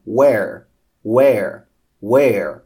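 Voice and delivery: "where" starting from half-closed lips, rounded w glide; teacher drilling "where" with a rounded w onset; clear English where. Shown as a demonstration. Teacher modelling "where" for Brazilian students, rounded w onset. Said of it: "ó".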